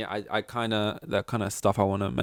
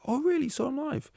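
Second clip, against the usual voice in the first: high, gushing stranger voice; eager stranger. Retold or imitated. New acquaintance asking about the concert, high and gushing. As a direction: chirp it up high like an impressed new friend.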